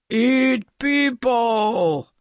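slow, drawn-out monster roar; roaring sea monster. A narrator's reading. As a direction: bellow it like a hungry beast. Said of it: "roared the sea monster".

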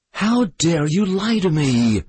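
high, loud furious scream; screamed in fury. A narrator's reading. The widow screams at the boy while beating him. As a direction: scream it between the blows. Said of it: "she screamed".

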